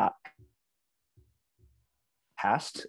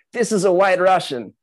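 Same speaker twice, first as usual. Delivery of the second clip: put-on loud, high Russian accent; 9 semitones higher, 8 dB louder than usual. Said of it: "With a Russian accent".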